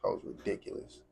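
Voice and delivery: whispered, under his breath; whispered aside. Real, not acted. Aside to viewers about his own rant, whispered. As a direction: drop to a whisper to comment on himself.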